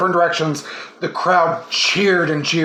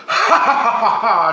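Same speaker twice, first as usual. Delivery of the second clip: triumphant laugh; 3 semitones higher than usual. A narrator's reading. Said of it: "she triumphed".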